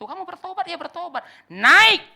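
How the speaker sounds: high, rising to a loud barked command; God's stern command, shouted at the end; voicing God's command. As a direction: order them up the mountain. God commands the sinner to climb.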